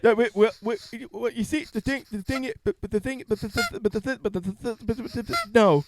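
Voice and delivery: high, stammering repetitions; stuck on the same words; mock stutter.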